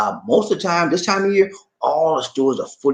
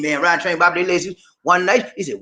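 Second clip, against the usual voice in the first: normal talking voice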